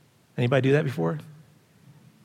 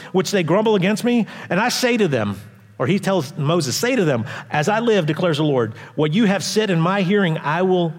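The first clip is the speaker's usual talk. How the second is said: loud, raised preacher reading God's words; the Lord's warning read at full voice; scripture reading; 5 semitones higher, 3 dB louder than usual. Acted. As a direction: proclaim it from the pulpit with weight. Reads scripture aloud with force.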